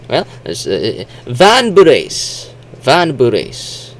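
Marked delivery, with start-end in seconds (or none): 1.2-3.9 "Bures" in two drawn-out beats, higher; Japanese-style pronunciation. Shown as a demonstration. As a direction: split the B and R of "Bures" with a vowel. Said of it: "how the Japanese would say".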